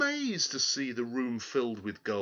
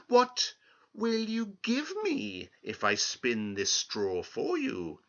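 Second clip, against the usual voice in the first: sly little man bargaining; 7 semitones higher than usual. Acted. Little man bargains slyly with the girl. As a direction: wheedle it out. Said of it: "he asked slyly".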